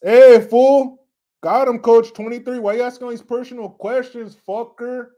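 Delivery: loud mock-offended outburst; mock annoyance. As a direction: jokingly confront them, starting with a shout. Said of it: "I'm kidding".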